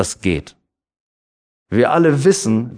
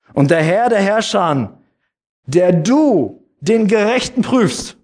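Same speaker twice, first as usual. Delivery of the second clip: raised, higher solemn reading of the Bible verse; scripture reading; 7 semitones higher than usual. Acted. Pastor to the congregation, reading Römer with raised solemn voice. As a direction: read it out to the congregation like proclaiming scripture.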